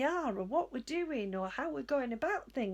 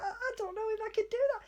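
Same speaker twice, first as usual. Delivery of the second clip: mock panic; 8 semitones higher, much faster than usual. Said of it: "And it was like".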